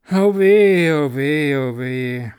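loud wailing cry fading to a sigh; sighing moan. A narrator's reading. Little Klaus, trapped in the sack, wails then sighs. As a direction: start as a loud cry and sink to a weary sigh. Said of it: "seufzte der kleine Klaus".

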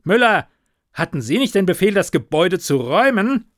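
loud, high, fast rebuke; angry command. A narrator's reading. Superior scolding his officer, loud and angry. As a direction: tear into the officer.

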